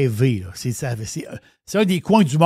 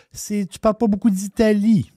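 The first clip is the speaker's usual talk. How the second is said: higher-pitched mock complaint; reproche imité; 7 semitones higher than usual. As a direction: imitate someone gently reproaching you. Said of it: "s'est fait reprocher à un moment donné". (reproche rapporté, voix plus aiguë)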